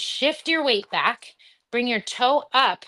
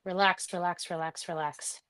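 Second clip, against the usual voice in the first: soothing relax cue; 4 semitones lower, 9 dB quieter than usual. Real, not acted. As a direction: ease them down, each relax quieter. Coaching a relaxing breath.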